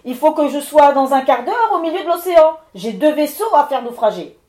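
stentor's cry. Acted. Levent roaring at the miller to go away, booming stentor shout. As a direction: bellow like a furious storm spirit. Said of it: "il lui cria d'une voix de stentor".

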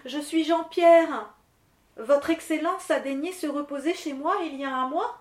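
a hint of a nervous peasant; lightly voiced miller. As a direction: announce yourself carefully to a great lord. Miller presents himself timidly.

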